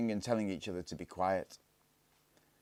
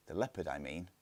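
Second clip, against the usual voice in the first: softer, lower clarification; quiet aside; 3 semitones lower, 6 dB quieter than usual. A narrator's reading. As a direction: hush it as a side note. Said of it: "said Barney".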